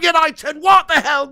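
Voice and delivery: ordinary delivery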